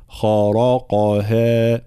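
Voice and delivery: خرقها very slow with heavy خ ر ق and light ه; tajwid demo. Shown as a demonstration. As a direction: stretch خرقها, heavy then light ending. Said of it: "تفخيم الخاء والراء والقاف ثم ترقيق". (تفخيم الخاء والراء والقاف وترقيق الهاء)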